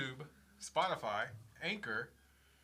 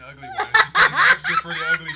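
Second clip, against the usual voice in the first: laughing; 10 dB louder than usual. Real, not acted. Imagines wife's words, laughing.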